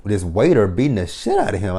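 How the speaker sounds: ordinary talk, nothing marked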